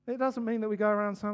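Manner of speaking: ordinary talk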